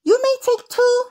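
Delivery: lady at the door sets a firm limit; prim permission. Retold or imitated. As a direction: grant it like a prim headmistress. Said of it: "I said".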